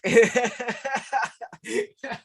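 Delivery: high-pitched real laugh. Real, not acted. Co-host cracking up at the wink joke, high laughter. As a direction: burst into a long, high giggle.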